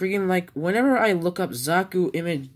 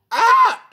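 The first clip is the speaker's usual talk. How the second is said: sudden high yelp, much louder; startled scream; 9 dB louder than usual. Real, not acted. Teen on camera yelps suddenly, startled scream. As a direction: shriek out in surprise.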